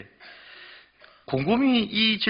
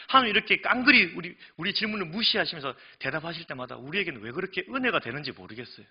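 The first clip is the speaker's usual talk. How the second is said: usual speaking voice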